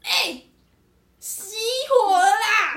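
high, loud, slow mock yell with a laugh; hammed-up cry that the engine died; imitated shout. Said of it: "然后他就大喊说".

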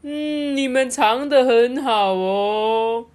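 high, loud, slowly stretched words; singsong teasing while searching; playful seeker. A narrator's reading. Blindfolded seeker to hidden friends, singsong playful call. Blindfolded doctor playing hide-and-seek.

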